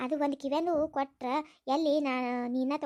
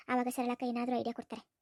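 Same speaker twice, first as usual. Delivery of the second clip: ordinary talk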